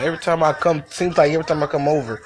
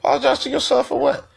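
incredulous reply; 11 semitones higher than usual. Retold or imitated.

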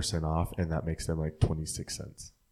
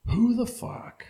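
incredulous; much slower than usual. Retold or imitated. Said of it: "I'm like".

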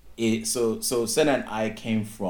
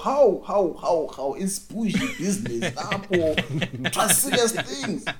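high, slow, mock-posh banter; mock posh greeting; 8 semitones higher, slower than usual. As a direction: tease a bougie friend, laughing. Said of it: "I was like".

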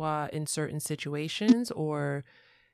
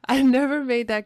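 much higher and louder, laughing through it; excited delight; 7 semitones higher, 8 dB louder than usual. Real, not acted. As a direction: burst out with delighted realization. Excited realization mid conversation.

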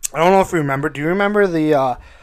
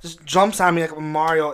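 ordinary talk, nothing marked

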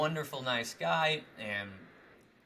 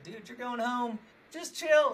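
inner voice; 12 semitones higher, 6 dB louder than usual. Retold or imitated. His heart speaking to him.

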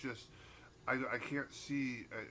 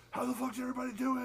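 high, fast rock-star greeting; mock frontman shout; 13 semitones higher, 4 dB louder, faster than usual. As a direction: hype the crowd like a frontman. Band frontman greeting crowd.

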